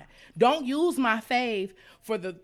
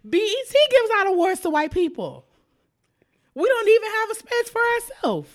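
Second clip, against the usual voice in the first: raised, louder indignant rant; heated rant; 10 semitones higher, 5 dB louder than usual. Real, not acted. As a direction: push it up, exasperated and loud.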